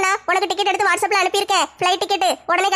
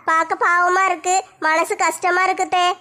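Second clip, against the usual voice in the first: usual speaking voice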